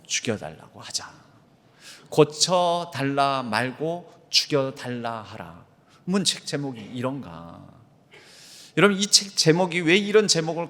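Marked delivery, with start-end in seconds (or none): 2.1-5.7 slow, weighty reading of the title; slow emphatic title. Shown as a demonstration. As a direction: lay each word down slowly. Reads the book title out.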